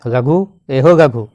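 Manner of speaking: calling out. Retold or imitated. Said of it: "हकवे लागल और बुलाने लगा".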